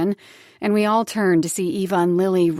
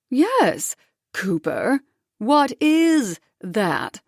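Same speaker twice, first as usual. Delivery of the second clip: slow, higher, clipped words; tight, bitten-out; 4 semitones higher, 3 dB louder, much slower than usual. Acted. Ex-wife seeing the ring, tight anger. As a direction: bite each word out. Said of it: "Jen bit out tightly".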